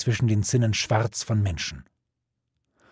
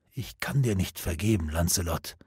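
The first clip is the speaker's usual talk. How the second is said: faint dying voice; 5 semitones lower, 7 dB quieter than usual. A narrator's reading. Dying Gawain refuses forgiveness.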